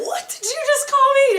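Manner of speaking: indignant coach. Retold or imitated. Coach voiced in story.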